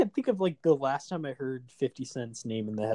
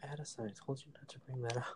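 quick, much softer secret aside; mock-private rapid reprimand; hushed scolding; 12 dB quieter, faster than usual. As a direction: scold privately in a near whisper. Callback to whispered private scolding.

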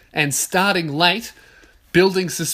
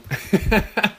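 laugh; 4 semitones higher than usual. Real, not acted. Laughs at funny memory.

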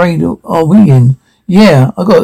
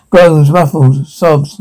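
normal talking voice

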